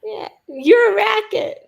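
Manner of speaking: normal talking voice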